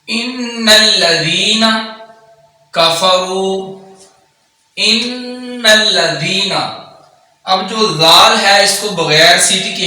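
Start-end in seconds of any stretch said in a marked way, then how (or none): 0.1-6.5 slow, drawn-out tajweed chant; Quran recitation. Acted. Teacher recites the verse slowly for students. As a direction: recite slowly, holding every long vowel.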